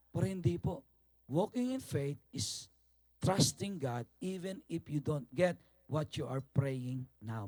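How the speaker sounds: normal talking voice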